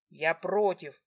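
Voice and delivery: loud, forceful protest; booming refusal; angry objection. A narrator's reading. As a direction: roar your objection, pitch held down. Husband roaring objection in quarrel.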